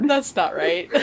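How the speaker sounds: loud, helpless laughter through words; laughing at oneself. Real, not acted. Co-host owning up to the space slip, laughing hard. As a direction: admit the slip while cracking up.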